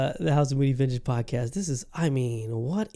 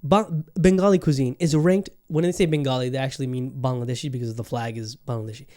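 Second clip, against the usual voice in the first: ordinary talk, nothing marked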